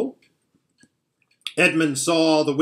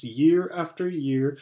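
usual speaking voice

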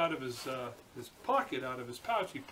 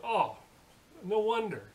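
loud, higher knowing exclamation; sudden realization; 6 semitones higher, 5 dB louder, slower than usual. Acted. Zoo officials hear what the man did, sudden realization. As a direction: react as the penny drops.

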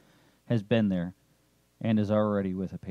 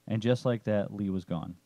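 ordinary delivery, nothing marked

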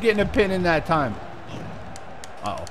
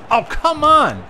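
frustrated yell; 8 semitones higher, 4 dB louder, slower than usual. Real, not acted. Frustrated gamer yelling at game.

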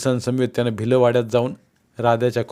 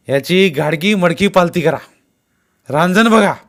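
raised-pitch, forceful search order; loud command; 6 semitones higher, 7 dB louder than usual. A narrator's reading. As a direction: order the men about loudly.

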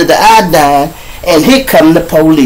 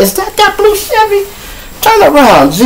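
high, sudden alarm at a passing car; alarmed shout. Retold or imitated. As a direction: warn the driver to turn around now. Alarm spotting rival car.

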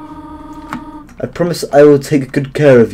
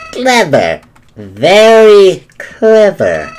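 slow, sneering Flowey; drawn-out sly mockery; Flowey impression; 4 semitones higher, much slower than usual. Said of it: "ready to hear my flowery impression".

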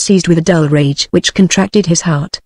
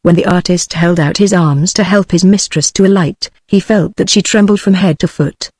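ordinary delivery, nothing marked

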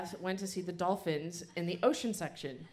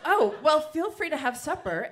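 higher, louder hostess offering supper; cheery mom; 8 semitones higher, 8 dB louder than usual. Acted. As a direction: welcome him in like a cheery mother.

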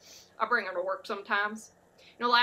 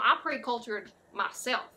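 ordinary talk, nothing marked

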